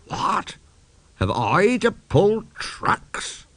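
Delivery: unvoiced gasp then high, slow disbelief; horrified young engine; aghast. Engine shocked at trucks.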